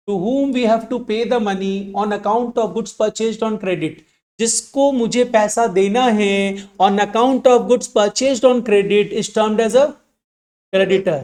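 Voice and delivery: very slow, word by word, for writing down; slow dictation. Shown as a demonstration. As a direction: dictate it slowly so students can copy. Teacher dictates creditor definition. (धीमे-धीमे लिखवाना)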